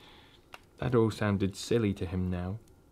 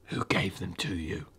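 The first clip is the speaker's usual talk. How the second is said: menacing cold whisper; 5 semitones lower, 8 dB quieter than usual. Acted. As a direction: hiss the question under breath. Witch questions the fox.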